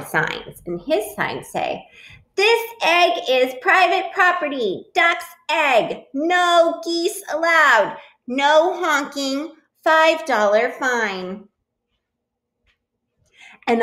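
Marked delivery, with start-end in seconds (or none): 2.4-11.5 high, emphatic announcement, slowing down; sign-reading proclamation. Acted. Duck's signs warn geese off, read as high proclamations. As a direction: proclaim each rule high and firm.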